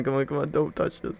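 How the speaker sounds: usual speaking voice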